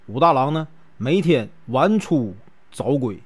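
ordinary delivery

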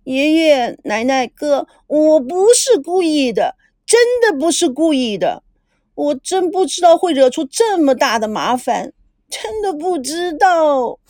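high, drawn-out tearful pleading; acted crying. A narrator's reading. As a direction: plead like a girl in tears. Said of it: "哭着说".

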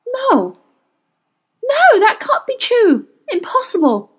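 high, strained denial; shocked disbelief. A narrator's reading. Witch learning she killed the boy's father, shocked denial. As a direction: recoil from it in high-pitched disbelief. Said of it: "shook her head and whispered".

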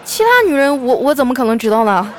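loud, high, stammering mock panic; panicked denial. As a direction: imitate a scared husband blurting his defence. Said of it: "我哥当时吓得呀".

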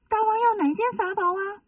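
high little-demon voice, asking eagerly. Acted. Little demon asks the king which treasure, high and eager. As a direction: pitch it up like a small servant demon.

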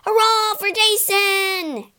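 high, slow cheer. Acted. Argonauts cheering Jason after the battle, high cheer. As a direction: cheer him on like a crowd. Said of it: "cheered the Argonauts".

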